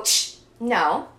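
short loud ch then a firm no; sharp corrective ch. Shown as a demonstration. Teaching listeners to stop a thought, firm. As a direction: snap the brain out of it. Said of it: "we go".

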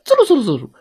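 high whooshing sound effect; imitated scurrying. A narrator's reading. Wolf dashing to his car, high scurrying sounds.

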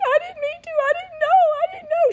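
very high, mock-tearful wail; crying her eyes out. Scolded girl pleading with big kids, mock crying. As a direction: imitate a child's upset pleading. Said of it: "She starts crying her eyes out".

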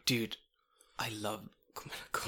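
fast, hushed confession; adoring whisper. Real, not acted. Host hoping for coffee sponsor, hushed adoration. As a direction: whisper it like a fan.

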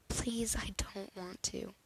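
soft, slow, unvoiced plea; pleading whisper. A narrator's reading. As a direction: beg him quietly, almost breathless. Pleading patient refuses.

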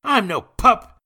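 put-on very high, loud boy's protest; high indignant outburst; snapped retort. A narrator's reading. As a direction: snap back defiantly as an angry boy. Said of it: "Jacques snapped".

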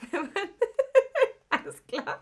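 breaking into laughter mid-sentence; words through a real laugh. Real, not acted.

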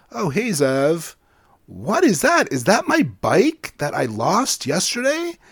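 put-on a kid spotting his lost bike; Murray's friend sees his bike, puzzled; surprised friend. Murray voiced higher.

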